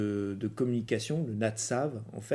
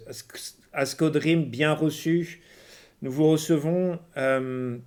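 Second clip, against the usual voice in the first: broken radio message; 4 semitones higher, 6 dB louder than usual. Acted. Spaceport reply, broken radio message.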